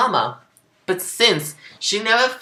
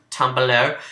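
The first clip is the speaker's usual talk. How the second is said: ordinary delivery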